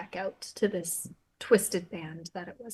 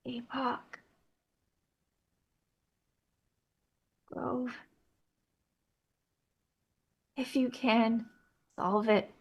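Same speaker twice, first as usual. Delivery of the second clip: raspy voice; 2 semitones higher, 7 dB louder than usual. Acted. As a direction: rasp out a strained, broken warning. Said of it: "raspy voice".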